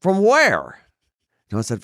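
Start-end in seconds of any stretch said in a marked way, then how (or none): 0.0-0.8 dry casting director. Retold or imitated. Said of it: "She goes".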